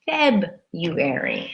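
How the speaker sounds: February slowly as FEB-yoo-air-ee, the first R silent; Feb-yoo-ary, slow. Shown as a demonstration. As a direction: drag out February with no R after the b. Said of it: "We say simply".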